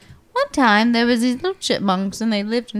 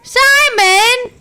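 high, loud cartoon chipmunk call; high chipmunk yell; 11 semitones higher, 5 dB louder than usual. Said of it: "this is their story".

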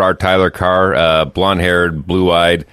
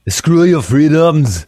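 loud-edged, high, slow Austrian accent impression; Arnold Schwarzenegger; 9 semitones higher, 3 dB louder, much slower than usual. Radio host quoting a star to his co-host, Austrian accent impression. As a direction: draw it out slowly in a hammed-up Austrian accent. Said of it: "He said it in a way cooler accent".

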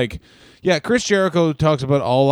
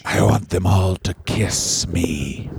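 low slow mock voice; 6 semitones lower, slower than usual.

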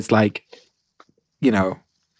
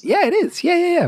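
warped high-pitched digital voice; garbled pitch-shifted call audio; glitchy jumping pitch. Real, not acted. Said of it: "Why do we sound so weird?".

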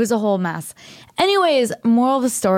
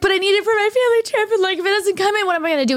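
high, fast, desperate whine; retold tearful plea, pitched way up; panicked crying plea; 11 semitones higher, faster than usual. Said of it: "I'm, like, crying on the phone".

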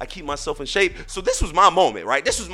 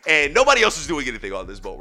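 normal talking voice

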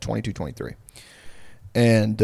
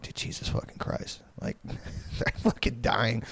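stunned whisper; 11 dB quieter, slower than usual. Retold or imitated. Retold shock at cigarette pile.

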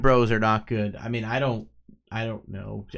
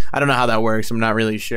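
usual speaking voice

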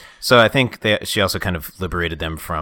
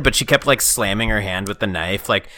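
usual speaking voice

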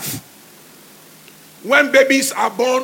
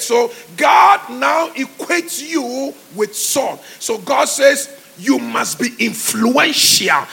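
normal talking voice

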